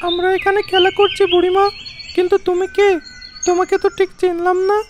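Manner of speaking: put-on high, curious child; children asking the stranger who she is. Children talking to old woman. (বুড়িমাকে প্রশ্ন করা শিশুর উঁচু কৌতূহলী গলা)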